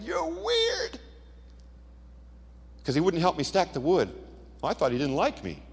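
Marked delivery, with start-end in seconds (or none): 0.0-1.0 much higher mock teen yell; father imitating his son yelling in the attic. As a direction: whine it like a sulky teenage son. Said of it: "He's yelling".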